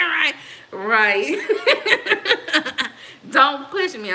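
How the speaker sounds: high laughter breaking up the words; laughing. Real, not acted. Cracking up at a comment.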